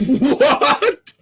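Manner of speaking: very high, loud disbelieving cry; can't believe what you're holding; shocked yelp; stunned shout. Real, not acted. Shocked by gift.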